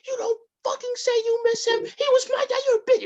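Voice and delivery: very high, loud outburst; mock furious screaming. Grieving mother attacks her family, hammed-up angry screaming. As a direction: imitate a hysterical mother lashing out.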